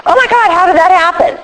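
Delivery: high, fake-shocked gasp of a line; imitating a woman shocked by her own weight; mock surprise. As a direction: play innocent about the weight gain. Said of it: "and we go".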